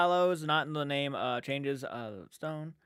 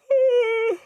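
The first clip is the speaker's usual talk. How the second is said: loud sigh; 11 dB louder than usual. Real, not acted. Big sigh after taking it back.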